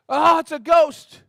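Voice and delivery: high, loud frightened cry of Oh; terrified shriek. Retold or imitated. Disciples in the boat seeing Jesus on water, shrieking in fright. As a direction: shriek it like you've seen a ghost. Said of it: "when they freaked out in the boat".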